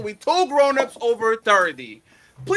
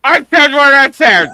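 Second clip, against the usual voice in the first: loud quote; 9 dB louder than usual. Retold or imitated. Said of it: "you said".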